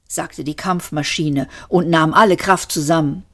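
usual speaking voice

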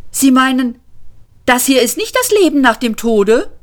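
bewildered surprised question; incredulous Arthur. Acted. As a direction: ask in amazement.

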